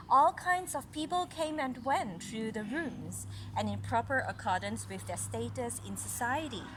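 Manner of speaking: clear, even read-aloud story voice; story narration. A narrator's reading. Mother reading a story to little Caris, storytelling voice. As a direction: read it like a picture book to a child.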